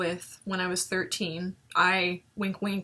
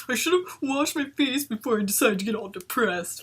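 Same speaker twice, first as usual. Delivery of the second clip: mock pitiful; 3 semitones higher than usual. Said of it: "I know I'm pitiful".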